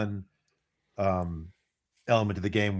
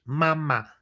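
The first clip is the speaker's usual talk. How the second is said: put-on baby's first word; 6 semitones higher than usual. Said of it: "the baby looks up and goes".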